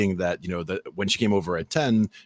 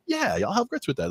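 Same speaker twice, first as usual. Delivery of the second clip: high-pitched mock-keen reply; mock eager order. Diner customer ordering grits, mock eager. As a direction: pretend grits are your usual order. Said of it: "something I would be like".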